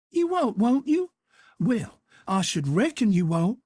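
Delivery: an aunt demanding names; scandalized auntie; incredulous outrage. A narrator's reading. Aunt Sally scoffs.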